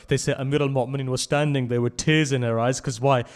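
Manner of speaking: loud mourning sermon, swelling; emphatic lamenting reciter; impassioned preaching. Acted.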